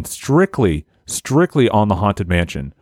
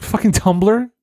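scoffing; 7 semitones higher than usual. Retold or imitated. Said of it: "It's like".

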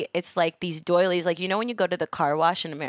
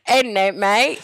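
loud, hammed-up British accent; 3 semitones higher, 7 dB louder, slower than usual. Shown as a demonstration. Guest copies host on request, hammed-up British accent. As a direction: imitate a British "innit, mate", playfully overdone. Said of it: "do your best British accent".

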